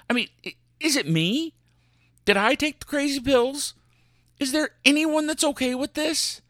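squeaky incredulous outrage; high exasperated. Real, not acted.

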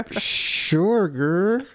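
high mock doubtful "sure, girl"; high, hesitant mock reply; skeptical drawl. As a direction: humor him with a sideways sure. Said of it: "And we're like".

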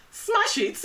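mock-excited mum voice; imitating mum. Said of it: "She's like".

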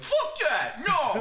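high-pitched mimicry of refusal; hammed-up outraged objection; indignant mock protest. Imitates friend's indignant reaction.

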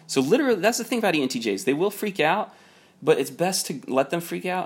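high excited emphasis on how they freak out; excited burst. Real, not acted. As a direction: jump up high as the point lands. Worked-up point about ENTJs.

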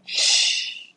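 a soft unvoiced hush before listening; hushing shh. Shown as a demonstration. Preacher quieting the new believer to listen, soft shh. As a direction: hush them so they can hear the angels. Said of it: "Do you hear them celebrating".

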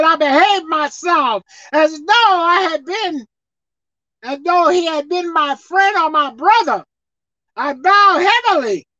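high, loud, slow reading of the verse; slow emphatic scripture; preached scripture reading. Acted. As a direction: proclaim the verse.

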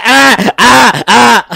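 loud, high buzzer noise; imitated buzzer. Host mocking the timekeeper's buzzer, loud high imitation. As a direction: blare it out like a game buzzer, three bursts. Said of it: "He made sure you heard that buzzer".

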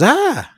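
high loud mock yelp; startled scream. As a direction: yelp as if scorched. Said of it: "and she goes".

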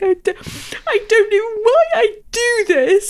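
put-on high, slow, crying kid's whine; whiny child. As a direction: whimper it like an embarrassed little girl. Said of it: "she was like".

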